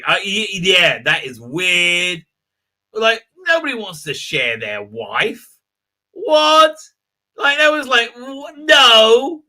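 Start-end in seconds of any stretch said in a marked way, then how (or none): 6.1-9.4 surprised. Real, not acted. Reacts to a weird chat message.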